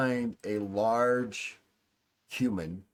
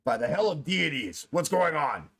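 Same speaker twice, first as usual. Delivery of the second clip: upset demand, pitched up; more into character; 6 semitones higher, 3 dB louder than usual. Acted. Upset man demands answers at the open door, irritated outburst. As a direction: play the irate man with a raised pitch. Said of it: "I gotta actually get more into it".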